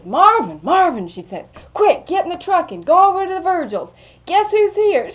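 high, loud urgent call across the house; loud excited shout for Marvin. Retold or imitated. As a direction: call him in a hurry, half laughing. Miss Mary calls for Marvin.

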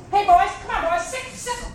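loud, high, urgent holler; calling the dogs. Acted. Frightened old man calling his dogs, shouting. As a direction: holler out quickly for the hounds. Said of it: "I'll call the dog".

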